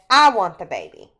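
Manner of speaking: loud, fast demand; mock shout. Husband yelling at his wife for the baby.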